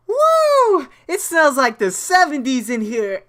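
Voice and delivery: loud high whoop of delight; amused boss. Retold or imitated. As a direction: whoop it out, tickled by the smell.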